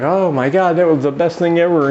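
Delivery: high, gushing mock delight; hammed-up amazed rave; ecstatic man. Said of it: "He's ecstatic".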